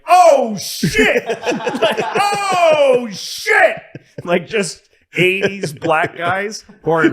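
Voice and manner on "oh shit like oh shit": high, loud hollered shock; hammed-up yell, stretched with laughter; mock astonished bystander. Bystander spotting the president outside a hospital, mock astonished holler.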